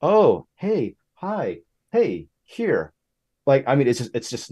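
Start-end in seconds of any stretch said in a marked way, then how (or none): 0.0-2.9 sing-out greetings with high, swooping pitch; polite, kind greeter. Retold or imitated. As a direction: greet everyone in turn, imitating a friendly PR person. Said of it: "to be very polite, to be very kind".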